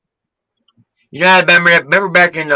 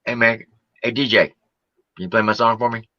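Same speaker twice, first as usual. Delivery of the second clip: quiet, low, mumbling plea to the DJ; mock Jason begging; 8 semitones lower, 8 dB quieter, much faster than usual. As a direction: beg the DJ under your breath. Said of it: "Jason J B will be up there".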